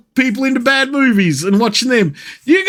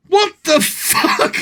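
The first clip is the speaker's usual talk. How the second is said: slow, high, stunned what the fuck; drawn-out stunned what; shocked disbelief; 8 semitones higher, slower than usual. Real, not acted. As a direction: gasp it out in disbelief. Shocked at the screen.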